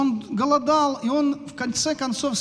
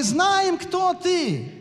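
demons shouting; 5 semitones higher, much slower than usual. Retold or imitated. Preacher voicing demons crying out, raised shout. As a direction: cry it out like a demon that knows him. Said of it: "бесы кричали".

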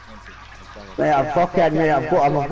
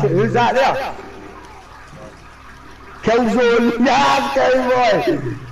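excited shout; 8 semitones higher, much slower than usual. Real, not acted. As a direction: yell to your teammate mid-fight. Calling teammate during firefight.

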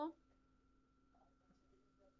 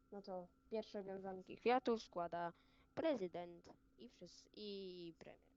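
ceremonial announcement. Acted. Announces wreath laying ceremonially.

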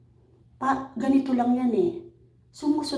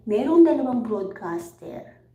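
usual speaking voice

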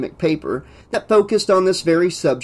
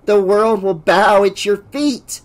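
fawning assistant; 6 semitones higher, 4 dB louder than usual. A narrator's reading. Fawning assistant praises his boss.

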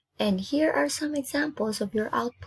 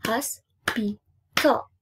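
HOSPITAL slowly split into hos, pi, tal; syllable by syllable; much slower than usual. Shown as a demonstration. Teacher leading the clapping technique, syllable by syllable. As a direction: clap out "Hospital" with a gap after each syllable. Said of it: "Do the clapping technique".

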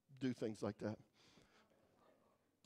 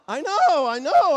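loud, higher "I know" over the crowd; excited over laughter; 6 semitones higher, 14 dB louder, slower than usual. Real, not acted. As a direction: ride the audience's reaction. Reacting to audience uproar.

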